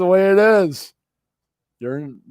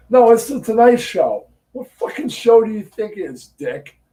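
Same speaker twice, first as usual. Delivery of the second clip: sarcastic mockery; 7 semitones higher than usual. Real, not acted. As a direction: sneer at the dumb question. Mocking a caller's dumb question.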